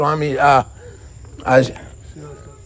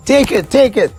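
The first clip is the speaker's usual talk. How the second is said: pressing offer; 9 semitones higher, 3 dB louder than usual. Acted. Lord urging gift on poor devotee.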